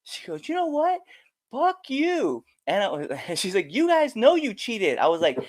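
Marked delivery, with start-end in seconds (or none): 0.5-2.4 high-pitched indignant retort; angry heckler. Retold or imitated. 3.7-5.0 high-pitched mock accusation; accusing heckler.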